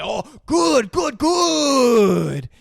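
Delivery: high, loud, effusive praise; delighted sushi chef. Retold or imitated. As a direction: gush over a pupil, hammed up. Retold delighted praise of pupil.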